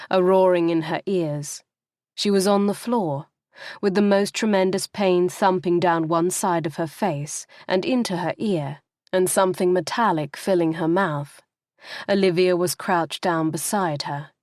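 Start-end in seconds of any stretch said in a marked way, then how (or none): none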